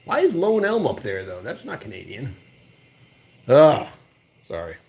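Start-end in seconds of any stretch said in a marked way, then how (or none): none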